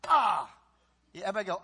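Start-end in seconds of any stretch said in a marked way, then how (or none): 0.1-0.5 loud groan of frustration; realizing a mistake, loud groan; frustrated groan. Shown as a demonstration. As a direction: groan at your own mistake. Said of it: "wrong side of the post".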